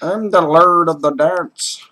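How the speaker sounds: high, loud, drawn-out proclamation; hammed-up, stretched-out boast of dancing glory; mock grand boast. Boasting to co-host about being dance master, mock grand.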